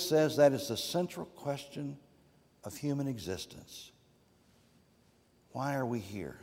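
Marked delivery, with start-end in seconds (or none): none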